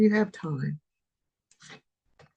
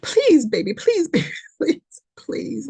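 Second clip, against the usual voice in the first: pleading again and again, a laugh slipping in; begging; 6 semitones higher, 3 dB louder than usual. Acted. The universe begs the viewer, pleading. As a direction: beg like the universe itself. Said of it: "it's like begging you".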